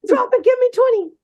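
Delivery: barked order, pitched high; high drill sergeant yell. Retold or imitated. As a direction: drill sergeant shouting at kids. Drill general yelling at kids.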